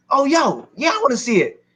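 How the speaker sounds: high, excited change of heart; eager. Retold or imitated. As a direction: act out an uncle suddenly keen. Uncle suddenly keen on property.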